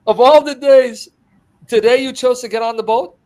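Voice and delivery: hammed-up scolding of a crewmate; exasperated disciple. Disciple blaming Peter as the boat capsizes, exasperated.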